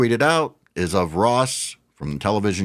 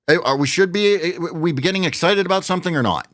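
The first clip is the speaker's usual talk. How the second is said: frustrated outburst; 7 semitones higher than usual. Real, not acted. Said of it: "I'm just so frustrated and annoyed".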